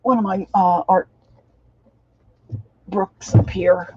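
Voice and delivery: straining while reaching; grunting with effort. Real, not acted. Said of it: "excuse me for grunting in your ear".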